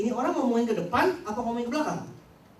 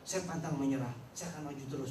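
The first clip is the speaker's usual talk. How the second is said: lower, soft, determined rich man; 8 semitones lower, 7 dB quieter than usual. Retold or imitated. Rich man voicing his dreams, low and soft. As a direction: mutter big dreams with resolve.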